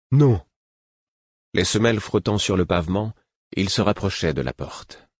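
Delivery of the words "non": blunt refusal. A narrator's reading. Lourd refuse.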